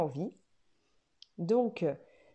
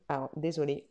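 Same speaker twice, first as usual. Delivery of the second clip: ordinary talk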